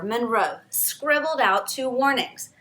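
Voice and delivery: ordinary delivery, nothing marked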